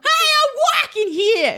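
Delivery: very high, slow hammed-up New Yorker; squealing slow mock New York accent. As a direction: stretch Hey out like an offended New York cabbie. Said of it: "try to do an accent".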